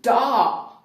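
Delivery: loud, abrupt one-word command; cashier's barked order. Retold or imitated. Cashier refusing money from the customer's hand, shouted order. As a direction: bark it as an order. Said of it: "and she goes".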